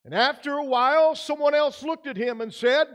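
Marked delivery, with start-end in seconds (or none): none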